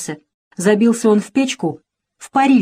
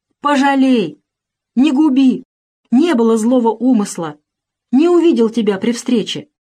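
higher, louder desperate begging; pleading; 4 semitones higher, 3 dB louder than usual. Acted. Peasant begs the boyar to stop, desperate plea. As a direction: beg the boyar for mercy under the whip. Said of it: "молит мужик".

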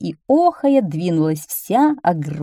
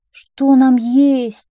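high, very slow loud wail; whining; 7 semitones higher, 6 dB louder, much slower than usual. A narrator's reading. Whiners asking the garbage man for food, whining. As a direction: draw it out as a plaintive whine.

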